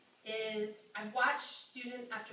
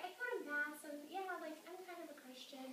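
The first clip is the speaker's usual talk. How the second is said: put-on hesitant college girl; 8 semitones higher, 4 dB quieter than usual. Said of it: "she's like".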